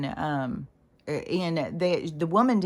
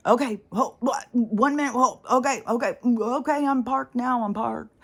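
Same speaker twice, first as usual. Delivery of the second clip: higher, louder, panicky stalling; flustered driver; 7 semitones higher, 4 dB louder than usual. Retold or imitated. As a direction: play a driver fumbling to park. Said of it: "And the guy is like".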